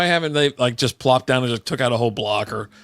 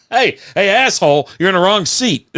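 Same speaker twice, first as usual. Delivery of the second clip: loud, raised mock call across a room; 6 semitones higher, 5 dB louder than usual. Coworker yelling to a man in the wrong seat, mock calling out.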